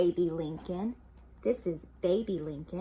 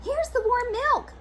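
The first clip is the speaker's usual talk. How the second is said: high, eager younger sister; Baby Lincoln voice; 7 dB louder than usual. Acted. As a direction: sound high and helpful.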